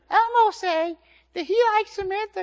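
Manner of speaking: very high, loud puppet voice; Elmo. Shown as a demonstration. Dad showing the children his old puppet voice, high Elmo falsetto. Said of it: "Could you guys like Elmo voice?".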